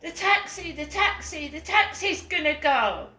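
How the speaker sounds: loud, high, urgent repeats; imitating mum's frantic yelling; shouting. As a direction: shout a pressing warning. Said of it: "she shouted at me like that".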